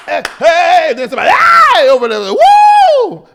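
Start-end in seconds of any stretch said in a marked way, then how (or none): 0.1-0.9 high, loud worship holler; church shout. Retold or imitated. Host acting out the storefront church praise, hollered. 1.3-1.9 very high, loud holler; church shout. Retold or imitated. Host imitating somebody hollering across the church, high shout. 2.3-3.3 very high, loud "Woo!"; praise whoop. Retold or imitated. Host acting out worshippers getting happy, whooped.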